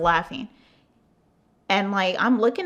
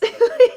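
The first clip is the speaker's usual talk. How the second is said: a sudden high, loud burst of laughter; 9 dB louder than usual. Real, not acted. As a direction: crack up at yourself. Laughing at herself crying over ex.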